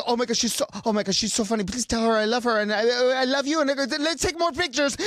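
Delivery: high-pitched, gushing imitation; excited gushing. Star sending love through the brother, gushing excitedly.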